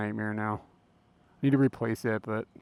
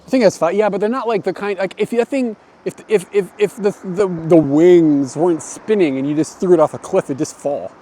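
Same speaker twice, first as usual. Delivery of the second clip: high, loud and fired up; excited rant; 6 semitones higher, 11 dB louder than usual. Real, not acted. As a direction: rant it out, pitch up. Heated rant about wings.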